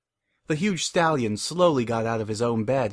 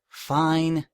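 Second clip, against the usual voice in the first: huffy; 5 semitones higher than usual. Acted. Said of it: "Twilight huffed".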